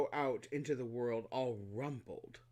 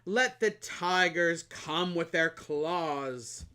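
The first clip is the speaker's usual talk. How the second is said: slow, loud, bragging flower; boastful flower; 4 semitones higher, 8 dB louder, much slower than usual. Acted. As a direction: brag grandly. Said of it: "she said".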